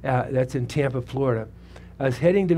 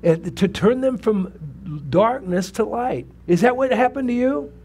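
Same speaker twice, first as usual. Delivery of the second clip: higher, louder fervent preaching; preaching at full force; 7 semitones higher, 3 dB louder than usual. Acted. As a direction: rouse the congregation with each line. Preaching the verse to congregation.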